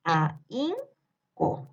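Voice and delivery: "A, in, co" spaced into three beats; teacher counting out "A, in, co"; syllable split. Shown as a demonstration. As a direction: sound out "A, in, co" one syllable at a time. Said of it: "se va a separar de esta forma".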